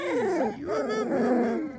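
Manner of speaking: high cooing to the puppy; high doting puppy talk. Real, not acted. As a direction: coo it up high. Cooing at puppies.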